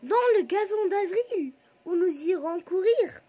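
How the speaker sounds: high, loud verse recitation; lifted, declaimed poem line; poem recital. Shown as a demonstration. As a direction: project the line brightly for the class.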